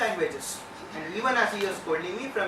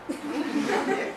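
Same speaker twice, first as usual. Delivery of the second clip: laughing; 9 semitones higher than usual. Real, not acted. Reaction to "first draw.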